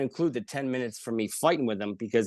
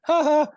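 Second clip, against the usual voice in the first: mocking laugh; 14 semitones higher, 8 dB louder than usual. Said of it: "He just went".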